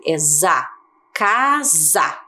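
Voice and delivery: casa, with the s said as a clear z; za, then casa, buzzing the s as z; z sound in casa. Shown as a demonstration. Teacher showing the s that sounds like z. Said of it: "agora o som é diferente".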